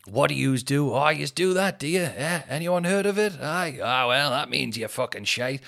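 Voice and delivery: hosting banter that roasts the office crowd; mock host ribbing. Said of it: "usually starts".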